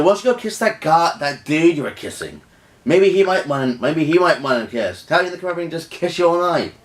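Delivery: high, mocking suggestion to a boyfriend; retold jealous retort.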